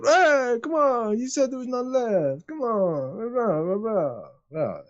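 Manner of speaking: high nasal-free whinge of patrons; whiny patrons. Acted. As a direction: whine like cheated drinkers. Patrons complaining in tavern.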